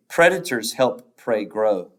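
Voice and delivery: normal talking voice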